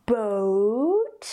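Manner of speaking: "boat" drawn out with a rising glide; long English diphthong in "boat". Shown as a demonstration. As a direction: stretch the two vowel sounds in "boat". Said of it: "A diphthong is a glide between".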